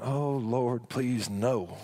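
low, groaning plea in dread; mock-despairing groan of a churchgoer; dreading congregant. Congregant dreading endless service, low groaning plea. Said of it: "Some of you are going".

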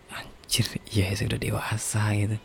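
near-whispered musing to oneself; hushed realisation. Real, not acted. Podcaster realising they are an adult now, hushed. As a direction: drop into a soft, whispery undertone.

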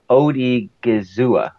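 slow, syllable by syllable; staccato. Shown as a demonstration. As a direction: break the name into syllables. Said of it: "I can do it if I do it syllable by syllable".

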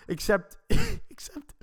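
high, slow words through laughter; cracking up. Real, not acted. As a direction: laugh so hard the word barely gets out. Cracks up at his own story.

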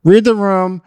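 normal talking voice

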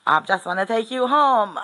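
loud, high, exasperated insistence; mock-angry, pitched well up; imitating angry-sounding man. Old bloke insisting on taking the stranger home, angry-sounding. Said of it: "he sounded really angry to me".

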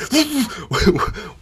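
high-pitched frantic effort noise; comic imitation of furious warming up; imitated frantic whirring. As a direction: mimic manic pedalling with a wordless noise. Imitates his furious warm-up noise.

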